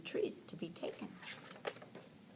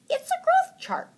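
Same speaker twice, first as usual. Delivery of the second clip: put-on very high, louder girlish beaver voice; Beaver's voice; 8 dB louder than usual. A narrator's reading. Beaver shows Franklin her growth chart, very high. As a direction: chirp it out proudly. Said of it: "Beaver explained".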